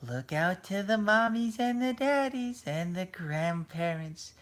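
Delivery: put-on high, drawn-out sing-song to children; old ride lady. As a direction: imitate an elderly host slowly coaxing little kids. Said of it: "And then she goes".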